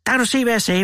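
high-pitched, mildly acted hiss. A narrator's reading. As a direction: snap it out as an accusation. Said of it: "hvæsede storebror". (hvæsende)

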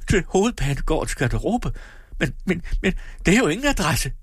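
high-pitched cry without full volume; alarmed cry. A narrator's reading. Husband protesting to Lina that it's no address, crying out. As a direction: stumble into disbelieving protest. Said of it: "råbte han".

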